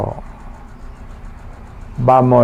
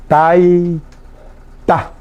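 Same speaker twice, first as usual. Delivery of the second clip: "Taita" very slowly, long tai, pause, then ta; syllable by syllable. Shown as a demonstration. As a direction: dictate "Taita" in two separated pieces. Repeats word slowly for learners. ("Taita" muy despacio, alargando "tai" y separando "ta")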